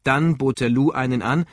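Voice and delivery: ordinary delivery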